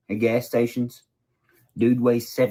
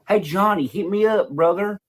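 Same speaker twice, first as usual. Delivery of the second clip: higher, chummy mock greeting; mocking Ronnie; 8 semitones higher than usual. Mocking Ronnie buttering up Johnny in a higher voice. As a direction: play a fake-friendly hustler. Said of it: "here comes Ronnie".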